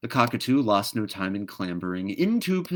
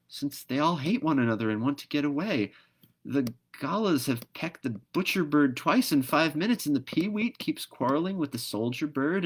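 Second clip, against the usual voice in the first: put-on higher, curious child voice; little girl voice; 7 semitones higher than usual. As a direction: ask like a wondering little girl. Dot, a little girl, asks.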